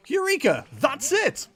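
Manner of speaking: high, loud burst of discovery; excited eureka. Acted. Player voicing a character's sudden idea, excited exclamation.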